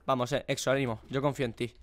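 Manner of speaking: low, soft reassurance; quiet encouragement. Real, not acted. Gamer encourages struggling friend, quiet and low.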